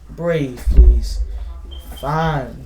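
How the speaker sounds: normal talking voice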